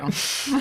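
quick high laugh; short laugh. Real, not acted. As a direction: laugh along. Laughs after missing Emma.

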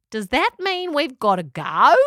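worried question; character voice. A narrator's reading. Pelican Marg voiced.

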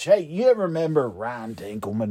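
ordinary talk

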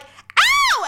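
very high, loud re-enacted shout of pain; pained yelp. Host re-enacting the fork hitting her toe, pained yelp. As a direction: yelp as if something just stabbed your toe.

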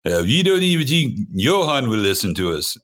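a hammed-up Swedish accent, as a Norwegian band would talk; mock Swedish accent, playful and clumsy; bad Swedish accent. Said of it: "That's my bad Swedish accent".

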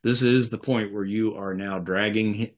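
usual speaking voice

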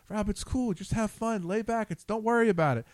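easygoing, mildly mocking cousin; cool fighter pilot. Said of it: "Mister typical cool Top Gun fighter pilot".

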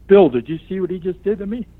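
playing the wronged victim; mock outrage. The wrestler whining to the referee Bill, mock outraged.

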